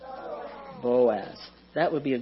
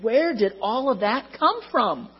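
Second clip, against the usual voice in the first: shocked; 7 semitones higher than usual. A narrator's reading. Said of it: "was shocked and said".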